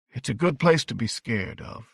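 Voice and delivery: said slowly and deliberately; slow, measured. A narrator's reading. Halloran answers the scared boy, slow and deliberate. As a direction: take your time over it. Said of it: "Halloran said slowly".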